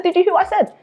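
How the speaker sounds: retold disbelief.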